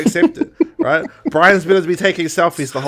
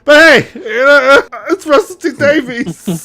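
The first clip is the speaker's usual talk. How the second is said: high, loud laugh-crying exasperation; half laughing, half despairing at the writer; 11 semitones higher, 4 dB louder, slower than usual. Real, not acted. Mocking the show's writer, laughing in high despair.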